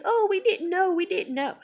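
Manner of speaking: high, singsong mock excuse; mocking coworkers. Mimicking coworkers' excuse, mocking and high.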